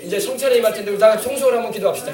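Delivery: ordinary delivery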